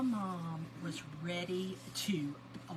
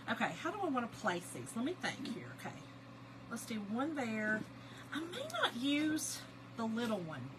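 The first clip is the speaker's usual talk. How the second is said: ordinary talk, nothing marked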